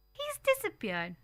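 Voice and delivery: cried out. A narrator's reading. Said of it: "I cried".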